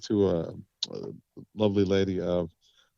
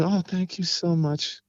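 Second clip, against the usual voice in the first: lightly voiced stage singer; 7 semitones higher than usual. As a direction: play the singer talking to the crowd. Singer addressing the audience.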